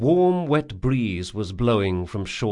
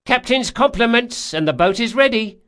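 higher, louder, brisk announcement; crew woman calling; 13 semitones higher, 5 dB louder than usual. Acted. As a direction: call the message through the door. Crew woman through door.

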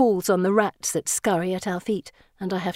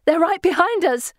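high, urgent alarm; panicked screech; 9 semitones higher, 4 dB louder than usual. A narrator's reading. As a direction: cry it out in panic. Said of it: "Felicity screeches".

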